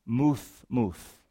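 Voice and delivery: "muth, muth" in a quiet Hebrew reading; Hebrew doubled word. Shown as a demonstration. Preacher sounds out the Hebrew word for die, quiet Hebrew pronunciation. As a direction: say "muth" twice, soft th at the end, falling low. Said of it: "again we see this doubling".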